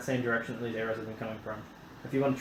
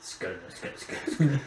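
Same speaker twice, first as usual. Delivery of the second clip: playful scatting syllables; mock Dwarvish scat; scat sounds; 5 semitones lower, much slower than usual. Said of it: "It probably sounds like scat".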